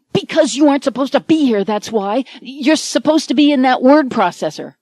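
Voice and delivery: fast, loud, exasperated. Acted. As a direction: snap it back in disbelief. Mike exasperated.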